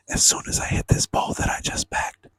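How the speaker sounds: a quick whisper under the breath; whispered aside. Real, not acted. To the viewers, a sneaky whispered aside. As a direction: whisper it, half to yourself.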